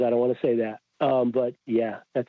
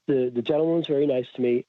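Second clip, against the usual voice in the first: ordinary talk, nothing marked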